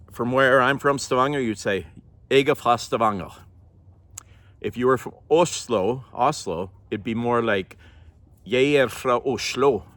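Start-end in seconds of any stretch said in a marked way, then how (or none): 2.3-3.3 Stavanger dialect. Shown as a demonstration. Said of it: "then I'll say it in different dialects". 8.4-9.8 "Jeg er fra Oslo" in an Oslo dialect, R rolled more. Shown as a demonstration. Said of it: "You roll your R's more".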